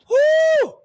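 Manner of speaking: high whoop of joy; excited whoop. Real, not acted. Host hyping her audience about Wendy news, high whoop. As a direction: let out a loud celebratory woo.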